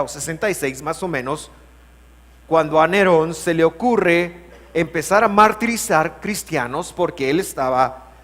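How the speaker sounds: normal talking voice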